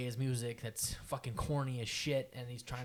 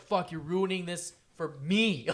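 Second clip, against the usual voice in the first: raised, slower mock rant at a bad performer; venting frustration; 4 semitones higher, 5 dB louder, slower than usual. Performer watching a bad act, venting in frustration. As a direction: let pent-up irritation spill out. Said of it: "You just got a lot off your chest".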